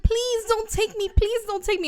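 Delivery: high, loud, drawn-out mock plea; fake pleading. Bride begging her captor without meaning it, mock pleading. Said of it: "in the back of her head".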